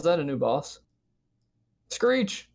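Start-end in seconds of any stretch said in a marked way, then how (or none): 1.9-2.5 high-pitched gorilla screech. Acted. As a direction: let out a sharp screech. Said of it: "He's just yelling".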